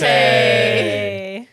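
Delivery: loud, high cheer for a toast; excited toast cheer. Real, not acted. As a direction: raise the glass and call it out.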